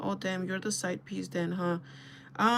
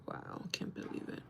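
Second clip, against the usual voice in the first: breathless whisper of disbelief; hushed, heartbroken whisper; stunned whisper; 12 dB quieter than usual. Retold or imitated. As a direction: whisper it like the wind got knocked out of you. Heartbroken reaction, quoted to herself.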